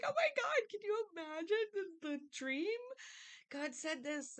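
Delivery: very high, near-crying wonder; awed excitement. Retold or imitated. Joseph telling his brothers his dream, overjoyed and high. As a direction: gush it up high like a thrilled kid. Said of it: "He's just like".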